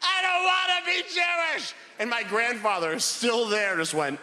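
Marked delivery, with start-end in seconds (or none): 0.1-1.7 mock hysterical child crying. Small boy wailing at his grandfather, high mock cry. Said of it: "I start crying hysterically".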